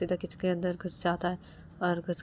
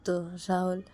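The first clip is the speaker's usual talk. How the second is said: ordinary delivery, nothing marked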